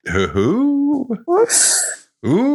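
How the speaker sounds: high hooting owl. Pretend. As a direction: hoot like an owl. Owl hooting as Owly Murs.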